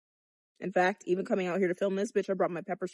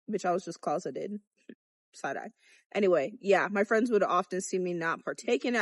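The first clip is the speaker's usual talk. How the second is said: usual speaking voice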